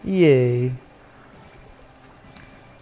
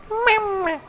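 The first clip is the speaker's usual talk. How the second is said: squeaky high mama. Pretend. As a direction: squeak it out like a baby voice. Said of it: "you gotta say it like that".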